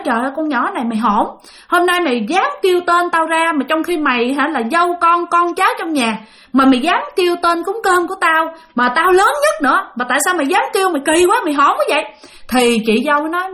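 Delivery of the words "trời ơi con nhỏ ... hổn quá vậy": higher-pitched, indignant telling-off; angry scolding. Retold or imitated. Eldest uncle cursing his niece-in-law for using his birth name, indignant scolding. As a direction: act out an elder's indignant rebuke. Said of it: "bác hai chửi quá trời".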